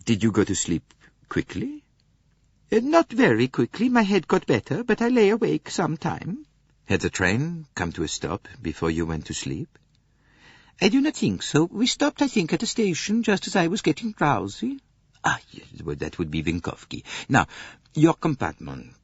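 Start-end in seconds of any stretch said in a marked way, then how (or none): 2.7-6.5 much higher, gentle Swedish lady; Greta Olson voice. Acted. Swedish lady answering Poirot's questions, high gentle voice. 10.8-14.8 much higher, gentle Swedish lady; Greta Olson voice. Acted. Swedish lady answering Poirot's questions, high gentle voice.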